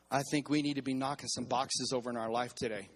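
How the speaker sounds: normal talking voice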